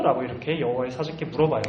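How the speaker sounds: ordinary talk, nothing marked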